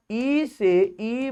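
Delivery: ordinary talk, nothing marked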